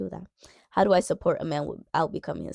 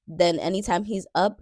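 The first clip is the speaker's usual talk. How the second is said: ordinary talk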